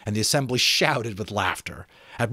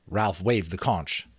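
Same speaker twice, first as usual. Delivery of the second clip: usual speaking voice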